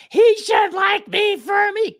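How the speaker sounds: high slow mock whine; mocking whiny woman. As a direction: whine it like a spoiled girlfriend. Mocking women's complaint.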